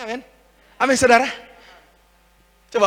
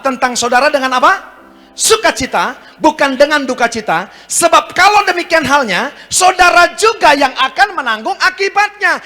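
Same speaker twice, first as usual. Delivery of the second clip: raised sermon voice with punched key words; emphatic preaching; 9 semitones higher, 7 dB louder than usual. Real, not acted. Preacher reading Hebrews with the congregation, emphatic. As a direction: declaim the verse phrase by phrase.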